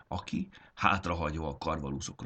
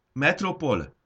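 much higher, loud call of a name; 10 semitones higher, 6 dB louder, slower than usual. Acted. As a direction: call out across the field. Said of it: "hangzott fel Larkin hangja".

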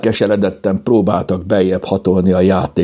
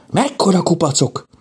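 high-pitched wonder at the sight; amazed exclamation; 12 semitones higher than usual. A narrator's reading. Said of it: "kiáltotta".

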